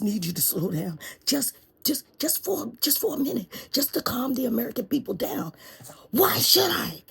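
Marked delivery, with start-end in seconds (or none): 1.3-5.5 high, timid begging, fading to a whisper; nervous pleading lawyer. Acted. Shaky lawyer pleading president. 6.1-7.0 high, loud, breathy challenge; defiant president. Acted.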